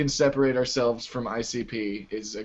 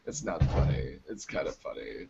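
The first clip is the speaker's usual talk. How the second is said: Floridian accent; 10 semitones lower, 6 dB quieter than usual. Shown as a demonstration. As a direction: sink into a laid-back Floridian accent. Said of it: "that's how Floridians talk".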